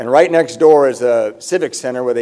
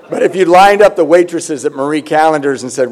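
ordinary talk

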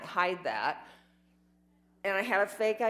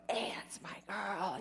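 soft, unvoiced, slower proud-dad aside; whispered fond greeting from dad; dad's proud whisper; 6 dB quieter than usual. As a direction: breathe it like a proud father to his buddies. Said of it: "and he'd go".

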